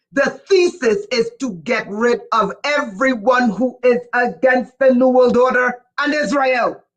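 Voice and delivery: normal talking voice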